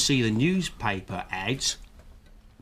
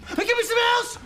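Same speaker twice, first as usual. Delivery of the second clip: hyper yell; 4 dB louder, faster than usual. Acted. Caffeine-crazed customer.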